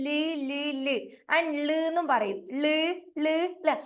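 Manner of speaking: teacher modelling a phonics sound; letter sound la. Shown as a demonstration. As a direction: repeat the sound ല് crisply. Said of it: "ല് എന്നല്ലേ പഠിച്ചത്?".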